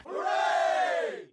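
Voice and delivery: high-pitched celebratory hooray; cheer. Pretend. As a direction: cheer for the students' success. Cheering her students' reading success.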